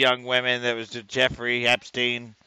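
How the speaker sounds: slow, boastful mock Trump drawl; Trump impression. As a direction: puff up like a bragging president. Mocking Trump voice.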